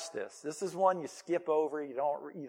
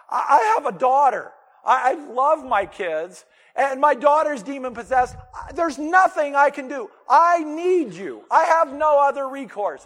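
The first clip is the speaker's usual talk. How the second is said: high, loud desperate plea; crying out; 8 semitones higher, 13 dB louder than usual. Retold or imitated. A desperate mother begging Jesus to heal her daughter, high loud plea. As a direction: cry out for help, raised and pleading. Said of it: "she's crying out".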